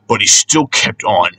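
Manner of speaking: ordinary delivery, nothing marked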